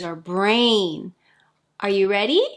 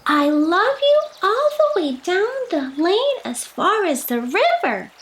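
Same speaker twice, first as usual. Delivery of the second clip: little hare calling out, voice raised high; excited cry; 10 semitones higher than usual. Acted. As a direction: call it far down the lane.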